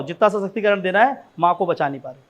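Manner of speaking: ordinary delivery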